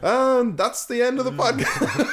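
high, loud, drawn-out mock ending; laughing sign-off. Real, not acted. Host jokingly ending the podcast after a quip, laughing announcement. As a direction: announce it slowly through laughter.

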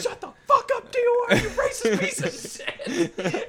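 very high, loud mock scream; hammed-up shouted insult; drill instructor yelling. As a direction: imitate an irate instructor through laughter. Drill instructor silences recruit.

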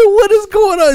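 high, loud cracked-up question; squealed retelling, nearly laughing; crying laughing. Retold or imitated. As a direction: act out helpless hilarity. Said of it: "I'm just crying right now".

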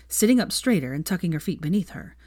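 ordinary delivery

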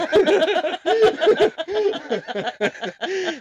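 high burst of laughter; loud laugh. Real, not acted. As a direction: crack up loudly. Big laugh at page joke.

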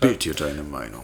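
tief, slogan voice; Werbeslogan zitiert. Retold or imitated. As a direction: sprich es wie ein Werbeslogan. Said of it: "das ist der Slogan von Bild". (tiefe Werbesprecherstimme)

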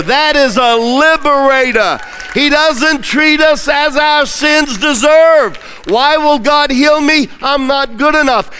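high, loud revival preaching; preacher roaring to the congregation about healing and breakthrough; full-force preaching. Acted. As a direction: build the fire and drive each line home.

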